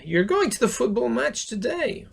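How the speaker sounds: high-pitched, keen reaction; acted keen interest. Acting interest in a match.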